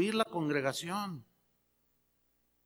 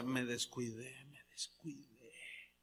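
low, broken sob-like repeat of a guilty man; crying confession; 6 semitones lower, 16 dB quieter, slower than usual. Retold or imitated. Man tells his pastor he slipped, weeping. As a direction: let the voice sink and crack with remorse. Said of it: "Y él lloraba y decía".

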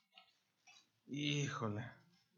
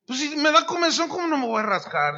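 mock indignant protest; 9 semitones higher, 7 dB louder than usual. Preacher protesting the itch rule.

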